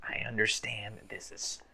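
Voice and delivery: soft, voiceless whisper in her ear. Acted. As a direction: lean in and whisper a confession. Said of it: "whisper in her ear".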